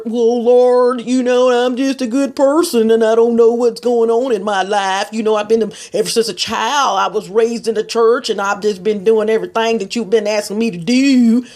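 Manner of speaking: loud, put-on Texas complaint to the Lord; hammed-up Texas accent, pleading; mock Texas prayer. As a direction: imitate a self-pitying Texan praying. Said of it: "I'm so glad I was born in Texas".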